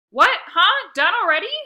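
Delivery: loud, high startled outburst; jolted awake, blurting in surprise. Acted. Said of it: "I mumbled, surprised".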